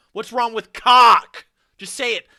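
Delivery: slow, drawn-out shouted question, pitched high; loud mock demand. Streamer mock-confronting the video narrator, slow loud shout. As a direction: stretch it out and yell it.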